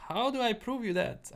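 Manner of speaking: high-pitched retold protest; retold incredulous question. Streamer answering people who thought he was from Texas, mock incredulous. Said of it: "I'm like".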